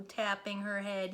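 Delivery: ordinary delivery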